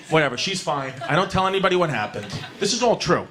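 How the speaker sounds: ordinary talk, nothing marked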